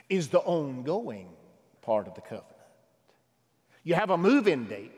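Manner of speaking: ordinary talk, nothing marked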